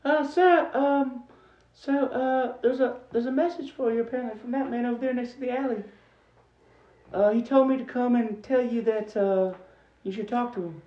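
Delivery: very high, soft, halting little voice; hesitant young messenger. Acted. Game master voices timid messenger Thomas.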